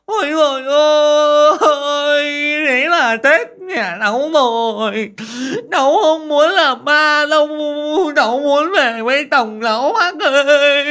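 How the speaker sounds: high, loud slow lament; woman wailing she'll become a ghost; wailing loudly. A narrator's reading. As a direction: howl it in despair. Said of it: "liền la toáng lên".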